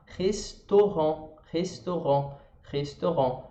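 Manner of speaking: slow restaurant with a throaty R and silent T; restaurant, the final T never said; French restaurant. Shown as a demonstration. Teacher drilling restaurant, slowly and clearly. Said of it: "É aqui na garganta de novo".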